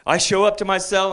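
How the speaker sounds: ordinary talk, nothing marked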